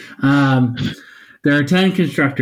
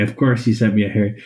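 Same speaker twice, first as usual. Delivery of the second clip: ordinary talk, nothing marked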